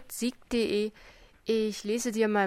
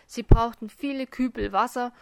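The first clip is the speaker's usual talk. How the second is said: plain reading voice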